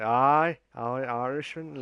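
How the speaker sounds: loud, slow mock Irish accent; bad Irish accent for the flying Irishman. Host imitates teammate's toast in mock Irish accent. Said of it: "I can't speak Irish".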